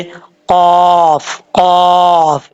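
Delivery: heavy throaty "क़ाफ", stretched; heavy qaf. Shown as a demonstration. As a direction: say "क़ाफ" slowly from the back of the throat. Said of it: "ये पुर पढ़ा जाता है".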